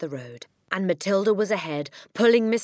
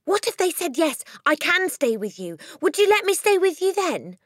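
high, loud eager question; bursting with a sudden hope; eager cry; 13 semitones higher, 6 dB louder than usual. A narrator's reading. Matilda eagerly asks her teacher. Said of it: "Matilda cried eagerly".

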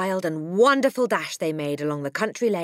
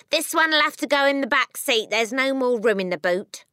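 mother voiced; 8 semitones higher, 6 dB louder than usual. A narrator's reading. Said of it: "His wife said to him".

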